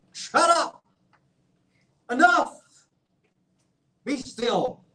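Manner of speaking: God rebuking the raging nations; thundering rebuke. Acted. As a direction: shout down a defiant enemy. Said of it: "these words were actually spoken to the nations who had been raging".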